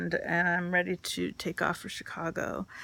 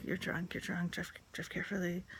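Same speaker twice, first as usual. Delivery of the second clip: retold self-warning; 9 dB quieter than usual. Drunk driver talking to herself on the drive, muttered self-warning. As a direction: coach yourself under your breath.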